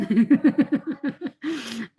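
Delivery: real rippling giggle; amused laugh. Real, not acted. Streamer hears why her sister laughs, giggling. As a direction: break into a run of chuckles.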